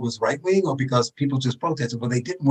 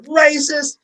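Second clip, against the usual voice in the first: high-pitched mock cry of outrage; mock accusation; 11 semitones higher than usual. Mimicking Trump's critics after the border call, high mock accusation. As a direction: throw it out high, like a heckler.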